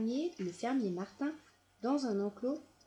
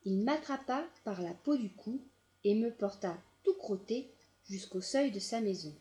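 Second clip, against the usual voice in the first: ordinary delivery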